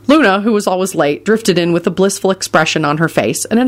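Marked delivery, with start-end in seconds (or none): none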